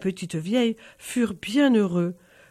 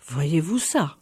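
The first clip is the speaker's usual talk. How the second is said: astonished; 7 semitones lower, slower than usual. A narrator's reading. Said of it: "s'étonna le barine".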